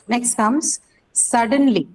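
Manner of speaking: normal talking voice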